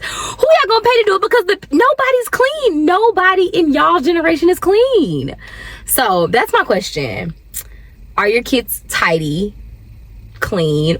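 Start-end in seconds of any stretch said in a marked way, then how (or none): 0.0-5.4 very high-pitched, exasperated protest; mom's squeaky mock outrage; incredulous retort. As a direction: argue back in comic disbelief, voice way up. Mock retort to her kids' plan.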